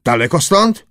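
keen inquiry; lively questioning. A narrator's reading. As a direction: ask it eagerly, pitch lifted. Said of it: "począł pytać żywo".

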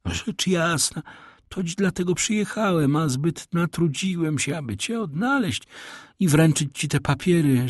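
a hint of a pompous old Italian; old major. A narrator's reading. The major bluffing to his fake son, lightly voiced.

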